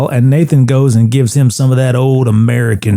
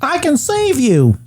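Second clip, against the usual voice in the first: high character voice; 13 semitones higher, slower than usual. Retold or imitated. Alex's line, quoted high and slow.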